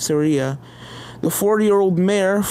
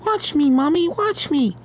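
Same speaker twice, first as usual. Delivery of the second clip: put-on much higher child voice, eager; excited little filly; 9 semitones higher than usual. Tiny Pegasus shows her mother a dive, eager child. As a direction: show off to mom.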